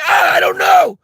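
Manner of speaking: very high, loud flustered cry; mock panic. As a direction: shriek it as an overwhelmed customer. Panicked customer answering fast server.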